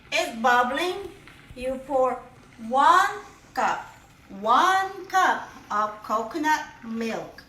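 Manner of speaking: ordinary delivery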